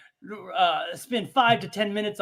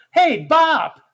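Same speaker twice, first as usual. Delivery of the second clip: put-on kids slang voice; 8 semitones higher, 8 dB louder than usual. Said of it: "we go".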